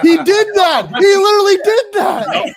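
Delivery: loud, high, drawn-out exclamation; excited shout. Real, not acted. Co-host confirming the autograph story, real excited shout. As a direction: shout it in amused disbelief.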